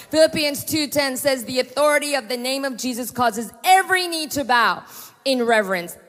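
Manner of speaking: rally the congregation to victory; preaching at full force. Acted. Preaching climax.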